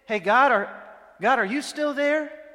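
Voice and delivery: high, loud call into the void. Retold or imitated. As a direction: shout up to God as if lost in space. Calling out to God in emptiness.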